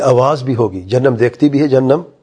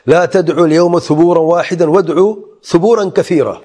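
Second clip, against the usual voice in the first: raised chanted Arabic verse; Quran recitation; 5 semitones higher than usual. Acted. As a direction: intone the whole verse high and melodic.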